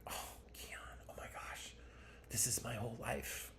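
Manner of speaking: whispered, breathless mom; soft whispered mother's confession; imitating mom's whisper. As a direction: hush it like a delighted secret. Mom's hushed reply about ayahuasca.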